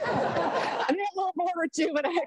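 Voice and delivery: high-pitched words through laughter; laughing. Real, not acted. Speaker jokes to audience after tangent, laughing.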